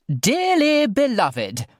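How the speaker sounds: high, slow, grand posh lady; loud posh voice. Acted. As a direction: boom it out like a plummy celebrant. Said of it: "in her loudest posh person voice".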